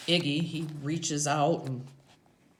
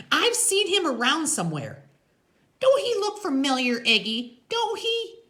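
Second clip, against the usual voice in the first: high, loud character woman; insistent high voice, prodding; Loretta, nagging Iggy; 7 dB louder than usual. A narrator's reading. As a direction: pitch way up as the biker's woman.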